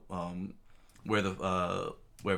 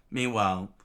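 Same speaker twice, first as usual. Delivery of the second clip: usual speaking voice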